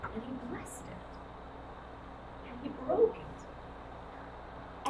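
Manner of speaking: slow reverent whisper; barely breathed, slow and sacred; hushed whisper. Acted. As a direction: whisper it slowly, in awe. Breaking bread, hushed awe.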